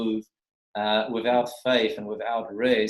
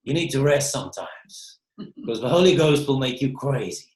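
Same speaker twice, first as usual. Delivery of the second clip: put-on preacher accent, louder and livelier; imitating David Hogan's accent; 3 semitones higher, 5 dB louder than usual. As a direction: mimic a fellow preacher's accent with gusto. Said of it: "in his accent".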